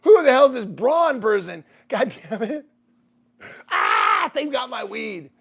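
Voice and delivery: loud, slow, high fake fury; mock outraged yell. Imagining the wrong person showing up, mock furious yell. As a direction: bellow it like a cheated buyer. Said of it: "I'd be like".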